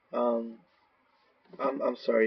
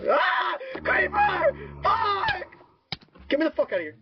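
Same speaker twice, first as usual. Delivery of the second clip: panicked scream. Real, not acted. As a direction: shriek as a creeper jumps out. Creeper appears, panicked screams.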